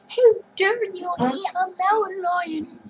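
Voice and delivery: high indignant imitation; outraged mock. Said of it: "And then they're".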